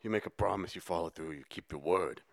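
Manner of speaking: low, soft tough-guy voice; Dominic Toretto. Retold or imitated. Podcaster quoting movie tough guy, low quiet menace. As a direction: deliver it as a hushed, solemn code of honour. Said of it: "Dominic Toretto".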